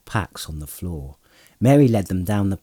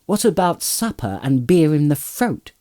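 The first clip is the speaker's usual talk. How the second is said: higher and louder, calling through the door; 7 semitones higher, 4 dB louder than usual. A narrator's reading. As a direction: call to the bathers from the passage. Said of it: "he called".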